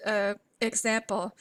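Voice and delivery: ordinary talk, nothing marked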